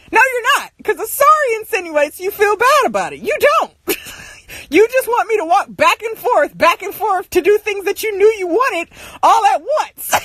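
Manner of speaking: high-pitched exasperated retort; re-enacted indignant rant; acted arguing. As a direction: argue back with pitch way up. Acted argument back at parent.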